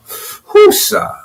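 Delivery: high breathy whoosah, let it all out; calming exhale. Shown as a demonstration. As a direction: blow out the tension on a high, airy breath. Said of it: "I'm bringing it down now".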